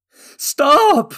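very high, very loud bellow; mother bursting in to stop the archers. A narrator's reading. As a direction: bellow it to halt everyone. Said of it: "a woman's voice bellowed".

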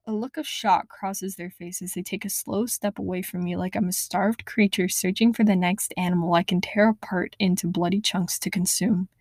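usual speaking voice

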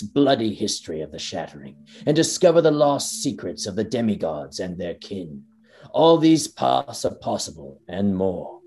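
grand, measured trailer voice, slowing into the last words; epic trailer narration. Acted. Podcaster reading the Elden Ring trailer aloud, epic narration. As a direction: narrate it like a fantasy game trailer.

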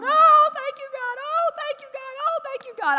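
acted high-pitched, loud cry of thanks; re-enacted wail of relief, pitched far above normal; relieved cry. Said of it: "I was so relieved because".

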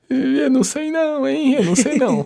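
high, unsure "I don't know"; doubtful voice. Retold or imitated. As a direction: play it skeptical and high. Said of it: "discursos do pessoal da igreja e ficar".

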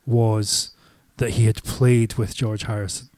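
ordinary delivery

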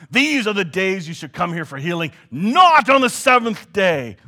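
the outraged leader berating the crowd for healing on the Sabbath; angry shout. Retold or imitated. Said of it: "he shouted angrily at the crowd".

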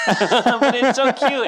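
high, loud, drawn-out laughing coo; laughing gush. Real, not acted. Co-host defends a cute toy, laughing high gush.

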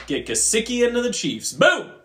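high excited boom. Real, not acted. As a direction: punch the boom up high and loud. Excited prediction.

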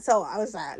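normal talking voice

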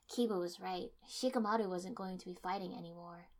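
usual speaking voice

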